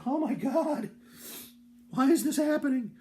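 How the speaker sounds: high, loud, disbelieving wail; mock horror. Boy watching the old man squat, wailing in horror.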